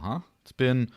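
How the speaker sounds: usual speaking voice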